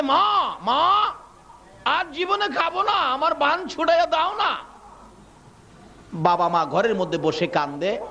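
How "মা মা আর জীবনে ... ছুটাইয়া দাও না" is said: high, wailing plea for mother; acted child calling out in distress; child's pleading cry. Tied-up child begs his mother to untie him, pleading high.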